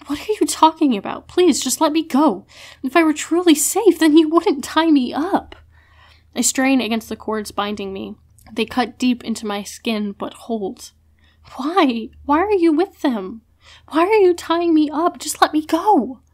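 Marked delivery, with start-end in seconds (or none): 0.1-5.5 higher and louder, begging to be let go; desperate pleading. Acted. Captive pleading to be released. 11.6-16.2 higher and louder, accusing and desperate; anguished demand. Acted. Captive confronts betraying friend.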